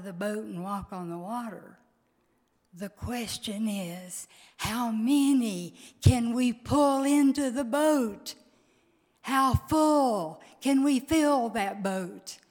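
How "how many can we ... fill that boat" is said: higher, louder preaching at full force; rally the congregation, voice rising; building sermon climax. Acted. Preaches the closing call.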